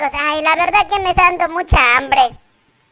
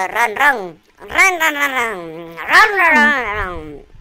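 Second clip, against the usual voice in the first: drawn-out playful purring, sliding down in pitch; pretend kitten purr; 6 semitones lower, much slower than usual. Player plays the kitten to his friend, pretend purr. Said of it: "Soy el gatito".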